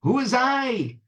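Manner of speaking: high, anxious woman's question; high-pitched wary old-tale woman; sick woman. A narrator's reading.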